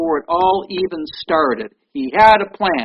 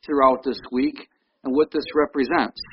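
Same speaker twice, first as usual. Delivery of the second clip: ordinary talk, nothing marked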